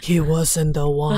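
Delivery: slow, deep documentary narrator. Retold or imitated. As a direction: deliver it like a hushed nature film. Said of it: "That was a better narrator voice".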